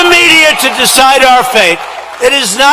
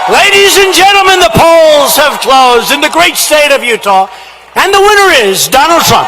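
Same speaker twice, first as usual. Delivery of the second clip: announcer voice; 4 semitones higher than usual. Retold or imitated. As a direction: proclaim the state result like a broadcast host. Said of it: "they said".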